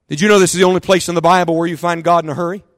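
usual speaking voice

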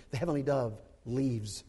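normal talking voice

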